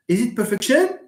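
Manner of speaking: perfection stressed on the last syllable, perfec-TION; second wrong version of perfection; wrong stress, quizzing. Shown as a demonstration. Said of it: "How do you pronounce this?".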